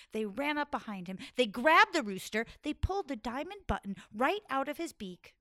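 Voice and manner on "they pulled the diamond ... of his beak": ordinary delivery, nothing marked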